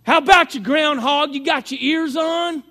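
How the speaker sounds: high, loud hailing over the radio; CB radio call. Acted. Old CB user hailing his buddy Groundhog, loud radio call. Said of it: "you remember you'd say".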